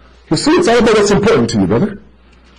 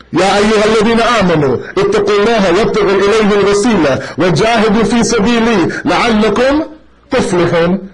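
fast, melodic Arabic recitation; chanted Quranic recitation in Arabic; 3 semitones higher, much faster than usual. Acted. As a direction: recite the verse as in prayer.

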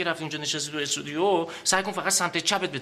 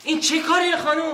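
much higher, louder alarmed protest; higher, louder startled protest; startled outcry; 11 semitones higher, 5 dB louder than usual. Acted. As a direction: jump in shock at the splash. Startled as water is thrown.

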